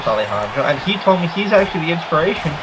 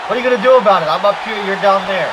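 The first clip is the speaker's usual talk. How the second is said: taunting wrestler; 5 semitones higher, 4 dB louder, faster than usual. Pretend.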